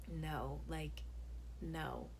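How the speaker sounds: soft, low, awkward honesty; reluctant refusal. Retold or imitated. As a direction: turn them down gently under your breath. Acts quiet refusal of kiss.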